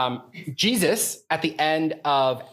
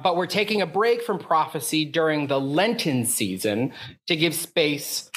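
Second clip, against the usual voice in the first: usual speaking voice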